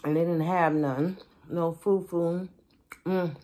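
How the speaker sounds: talking between bites; chewing while talking. Real, not acted. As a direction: mouth partly full. Seen 0: Fufu eaten at -4, chews while talking; tripe only held in hand.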